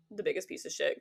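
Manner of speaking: usual speaking voice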